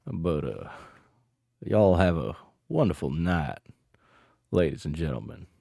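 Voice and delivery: put-on mosey along in a big Texas cowboy accent. Streamer signing off to chat in a Texas accent. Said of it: "I'll do the Texas accent".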